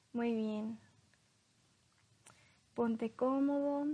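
calm hypnosis guide, long pauses. Acted. Leads a hypnosis induction. (voz lenta de hipnotizador)

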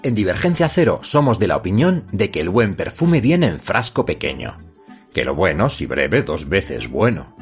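locutor de radio, enfático y cercano; right in your ear. Acted. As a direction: presenta el programa como un locutor. Intro de podcast con música. (voz de locutor pegada al oído)

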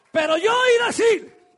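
high, loud, shouted preaching over music; fiery revival preacher crying out to the church; full-force preaching. Acted. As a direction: preach it to the rafters, building.

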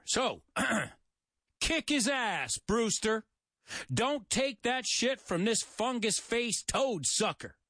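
mock Southern heckler, slow; southern twang. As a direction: play a rowdy Southern sidekick egging on a fight. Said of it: "in my southern twang over there".

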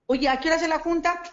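eager question. Retold or imitated. Recruiter retelling how the prize car made her eager. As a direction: jump at the chance, all interest now.

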